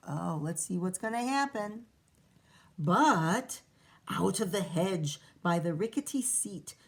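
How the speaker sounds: plain reading voice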